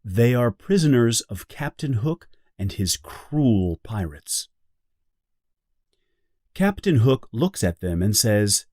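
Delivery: ordinary delivery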